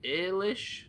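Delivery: "Ailish" tried out as a rising question; Ailish guess. Shown as a demonstration. Guessing a viewer's name aloud, "Ailish?" rising. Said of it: "Is that how you say it".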